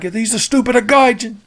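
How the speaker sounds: high-pitched mock Italian rant; Italian accent. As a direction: put on an angry cartoon Italian accent. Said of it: "Like how he became Italian".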